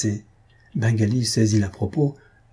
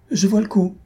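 exclaiming; 8 semitones higher than usual. A narrator's reading. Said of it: "s'écria mademoiselle Piedvache".

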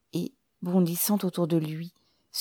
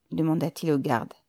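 plain reading voice

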